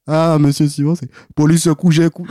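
high, loud mock Antillean accent; teacher's Creole accent. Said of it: "ton professeur qui prend aussi l'accent".

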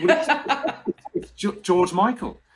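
laughing it out, high and excited; giddy editor spilling the secret; laughing reveal. Retold or imitated.

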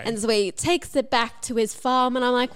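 usual speaking voice

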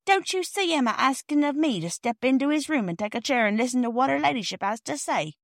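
high, sarcastic cockney porter; indignant. A narrator's reading. Porter mocking the children's claim, indignant sarcasm. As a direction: scoff with wounded pride. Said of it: "said Perks indignantly".

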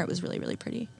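breathy whisper, gushing softly. Real, not acted. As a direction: hush it like a secret delight. Dreamy praise of wine.

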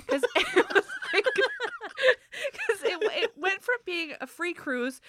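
high, loud, words breaking up in laughter; real laughing fit. Real, not acted. Cracking up over the cruise upsell, laughing hard. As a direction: fight to talk through laughter.

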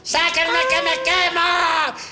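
high, drawn-out comic cry; desperate mock scream. Bread trapped in the stone oven, hammed-up scream. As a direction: wail it high like a cartoon in peril. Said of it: "hay algo gritando así de".

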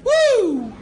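high, loud fake cheer; a hammed-up high woo from the crowd; mock audience whoop. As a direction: whoop like a few keen punters. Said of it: "four or five people in the audience will just go".

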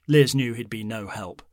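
usual speaking voice

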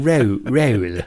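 Bristolian vowel on "Roll, roll"; exaggerated Bristol twang on each word; Bristol accent. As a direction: mimic a Bristol man saying "roll". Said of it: "kept saying".

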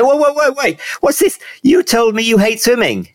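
high-pitched incredulous challenge; retold disbelief. Runner challenging a friend who claimed to hate swimming, incredulous. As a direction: voice jumps up, calling out a contradiction.

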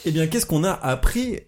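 put-on high, slow teacher quizzing little kids; sing-song classroom teacher, drawn out; schoolteacher voice. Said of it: "T'as fait une voix de maîtresse un peu".

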